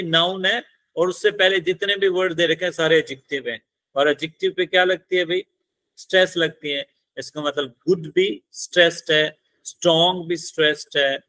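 normal talking voice

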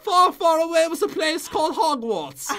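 high, slow hammed-up British storyteller; British accent. Player spinning a tale for a viewer, mock British accent. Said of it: "read this in a British accent".

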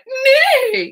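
high, loud whinny; rude horse neigh. Acted. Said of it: "a bit rude. He went".

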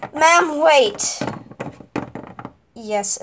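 ordinary delivery

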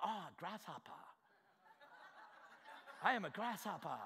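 hushed, laughing self-pity as a grasshopper; mock the meek, shrinking voice; soft, mocking grasshopper whine; mock small voice. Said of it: "You go".

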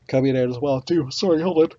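talking through a yawn; words stretched by a real yawn. Real, not acted. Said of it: "Sorry, I'm yawning".

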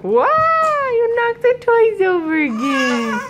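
high, loud sing-song wow; mock surprised wow. Real, not acted. Baby knocks toys over.

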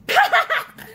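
a short, high mocking laugh; scornful laugh; sneering laugh. A narrator's reading. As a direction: laugh at him with contempt. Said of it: "Matherson laughed".

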